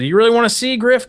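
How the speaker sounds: a hint of an incredulous master sergeant; retold sergeant.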